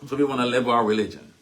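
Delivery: ordinary talk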